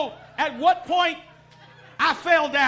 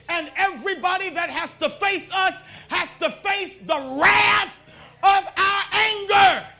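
full-force preaching; 3 semitones higher, 5 dB louder than usual. Acted. Preacher warns the congregation, building to a roar. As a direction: thunder the wrath out to the church.

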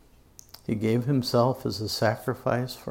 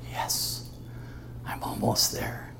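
gloating whisper; 7 dB quieter than usual. Acted.

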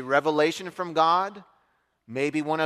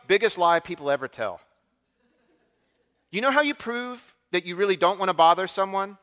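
usual speaking voice

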